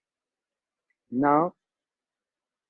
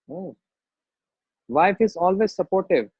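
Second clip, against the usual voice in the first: ordinary delivery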